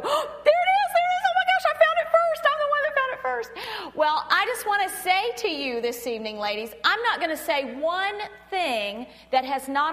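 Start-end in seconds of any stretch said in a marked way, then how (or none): 0.0-4.0 high, loud squeal of triumph; excited discovery. Acted. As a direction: crow gleefully at being the first to spot it. Acting out finding the puzzle difference.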